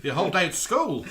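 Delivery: high, loud mock Aussie; laughing through a put-on Australian accent. Joining the teasing about school, mock Australian accent. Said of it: "We've been doing Australian accents to you all evening".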